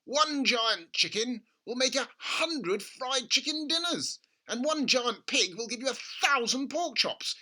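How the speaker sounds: gushing over a money-making idea; excited father. A narrator's reading. Father urging his son at breakfast, excited. Mr Cranky voiced.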